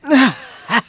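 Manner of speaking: high burst of happy laughter; delighted laugh. Real, not acted. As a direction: laugh as you sink into the bed. Flops onto the luxury bed.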